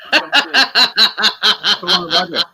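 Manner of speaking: laughing in disbelief, drawn out. Real, not acted. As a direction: laugh through the words slowly. Amazed at own old jumper.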